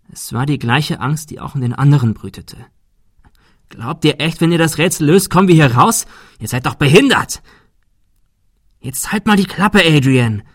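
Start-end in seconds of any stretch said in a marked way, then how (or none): 3.7-7.4 high, loud outburst edged with panic; loud, panicky. A narrator's reading. Adrian challenges the others over the riddle, loud and panicky. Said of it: "Er wurde laut und klang panisch". 8.8-10.4 high-pitched, irritated telling-off; sharp rebuke. A narrator's reading. Shirley tells Adrian to stop, snappish. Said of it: "fauchte Shirley leise".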